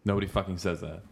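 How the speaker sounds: ordinary talk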